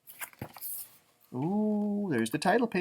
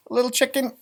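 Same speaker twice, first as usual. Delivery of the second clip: usual speaking voice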